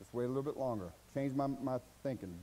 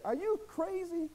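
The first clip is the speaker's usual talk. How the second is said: incredulous retort; 13 semitones higher, slower than usual. Real, not acted. Answers the critics indignantly.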